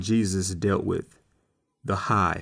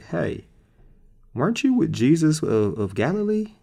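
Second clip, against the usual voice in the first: higher voice, rising into the question; lightly acted suspicious girl, pitched up; 6 semitones higher than usual. Girl comes up to Peter after the arrest, suspicious questioning.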